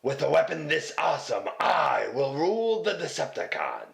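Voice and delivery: gloating cartoon villain boast. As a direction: boast like a scheming robot villain. Toy villain boasting with weapon.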